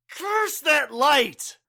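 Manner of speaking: high, slow, drawn-out curse; a slow, high, anguished curse; pained curse. Acted. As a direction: spit the curse out in pain. Blinded, cursing.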